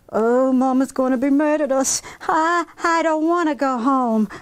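put-on high, anxious child's whimper; worried whine. Claire dreading Mama's anger, worried whine. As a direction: fret like a little girl dreading trouble.

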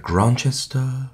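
the name lifted high and out, full of longing; longing call. Acted. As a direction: call the place name out as if across the sea. Poem, the beloved place name called.

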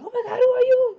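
shrieked high and loud through a laugh; mock freak-out. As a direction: play a startled woman screaming, half laughing. Belle freaking out on waking.

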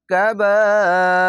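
কাবাইর chanted high and loud, the long vowel held four beats; four-beat madd. Shown as a demonstration. Teacher demonstrating madd lazim to students. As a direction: hold the আ in কাবাইর for four counts. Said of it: "মাদ্দে লাজিম চার আলিফ টেনে পড়বেন".

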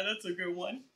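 ordinary talk, nothing marked

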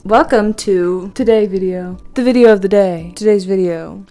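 formal newscaster read. Acted. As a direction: announce it like a TV anchor. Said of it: "my newscaster voice".

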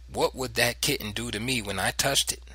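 ordinary delivery

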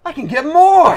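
high, loud pretend disbelief; hammed-up astonishment; mock amazed customer. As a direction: play the customer thrilled by the news.

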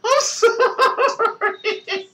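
high, slow apology squeezed out through laughter; can barely get the words out for laughing. Real, not acted. Vlogger apologizing to viewers while helplessly laughing. Cracking up at a commercial.

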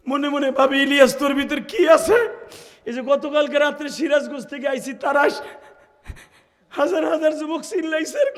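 high-pitched, fervent testimony; impassioned preaching. Real, not acted. Preacher telling of weeping crowds, impassioned.

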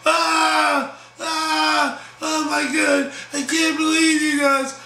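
high wails into a shrieked complaint; mock screaming. Sophia screams at the guys after the fight, high mock outrage. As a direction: imitate a woman screaming at the guys, pitched high. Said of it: "Sophia screaming".

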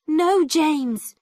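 high, loud cry of protest. A narrator's reading. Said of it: "I cried".

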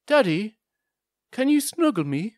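put-on high, small child's "Daddy, can you snuggle me?"; little girl voice. Daughter asking daddy at bedtime, high little-girl voice. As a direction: pipe it up like a sleepy little girl. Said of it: "she said".

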